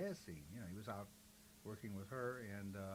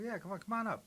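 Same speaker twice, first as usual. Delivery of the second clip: eager invitation; 10 semitones higher, 5 dB louder, faster than usual. Retold or imitated. Drummer's invitation retold.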